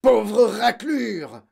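loud, high, drawn-out screech; screeched abuse; yelping insult. A narrator's reading. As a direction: yelp it with furious contempt. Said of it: "glapit mademoiselle Legourdin".

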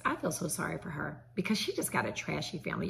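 normal talking voice

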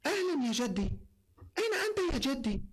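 put-on high eager little boy; bright kid asking grandpa; child voice. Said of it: "شخصيات باش تدخل في الحكاية".

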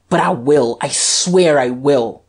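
slow, emphatic vow; insistent cry. A narrator's reading. Guerchard vowing arrest to the Duke, emphatic cry. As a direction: swear it with force. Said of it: "cried Guerchard".

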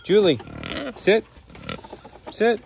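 high, loud, repeated command; calling a command. Real, not acted. Keeper telling Julie the pig to sit, loud command. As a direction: call it out high and firm.

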